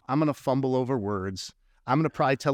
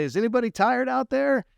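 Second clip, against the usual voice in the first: preacher asking crowd; 9 semitones higher than usual. Retold or imitated. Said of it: "and I say".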